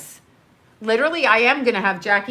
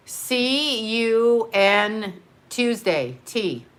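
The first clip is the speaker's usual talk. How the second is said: slow spelled out; much slower than usual. Shown as a demonstration. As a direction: spell out C U N Tuesday letter by letter, very slowly. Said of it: "what does see you next Tuesday mean?".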